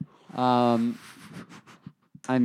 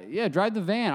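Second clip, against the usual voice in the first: imitating buddy; 8 semitones higher than usual. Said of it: "And he goes".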